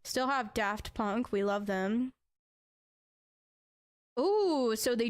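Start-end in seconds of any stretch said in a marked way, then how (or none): 4.2-4.8 a high, loud ooh of delight; excited ooh. Real, not acted. Streamer seeing spooky offers for viewers, excited ooh. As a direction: react like you just found treats.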